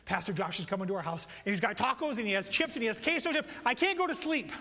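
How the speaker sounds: put-on child telling parents, rising to excited squeal; excited kid. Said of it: "they told their parents".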